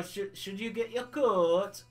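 mock Yorkshire with drawn-out vowels; Yorkshire accent. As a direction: imitate a Yorkshire coworker, stretching the last word. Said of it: "worker who's originally from Yorkshire".

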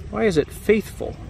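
normal talking voice

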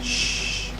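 long, very quiet shh; soft shushing. Real, not acted. Gamer hiding from the monster, soft shushing. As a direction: hush softly so nothing hears.

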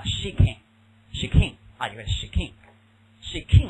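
shaking repeated slowly; teacher drilling the verb; slow drilled word. Shown as a demonstration. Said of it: "drills verb".

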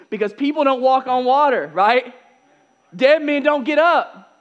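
loud, high-pitched mock panic; incredulous exclamation. Explaining why disciples think it's a ghost, mock panicked disbelief.

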